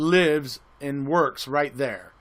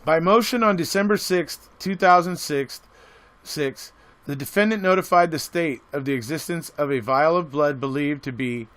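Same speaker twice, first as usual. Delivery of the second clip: louder, formal read of a court filing; reading a document aloud; 5 dB louder than usual. Acted.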